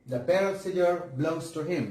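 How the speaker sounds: slow, each word apart; slow dictation. Shown as a demonstration. Teacher rereading the sentence for the class, slowly. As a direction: read it slowly for checking. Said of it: "সেন্টেন্সে কি ভুল রয়েছে?".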